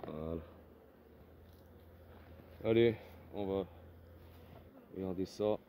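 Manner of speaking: nasal voice from a summer cold. Real, not acted. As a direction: speak through a stuffy, nasal nose. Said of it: "Avec ma voix na-, nasillarde".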